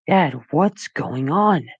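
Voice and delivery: slow, drawn-out plea; slow pleading. A narrator's reading. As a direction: stretch the question out, fearful. Max pleading slowly.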